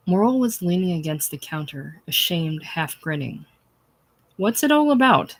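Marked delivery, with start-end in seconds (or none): 4.4-5.4 much higher, louder snap; an employer snapping at the quarrelling men; snapped. A narrator's reading. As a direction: demand it sharply. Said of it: "snapped Thomas Jordan".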